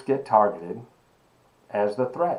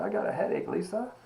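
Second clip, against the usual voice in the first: breathy, whispered plea; mock-pitiful whisper of a headache; weak retold complaint; 5 semitones higher, 13 dB quieter than usual. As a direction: whimper it to the secretary, almost no voice. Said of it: "And I'm about in tears".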